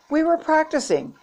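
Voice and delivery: high, over-innocent fib; fibbing. A narrator's reading. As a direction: pitch it up like a hasty cover story. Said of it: "she fibbed".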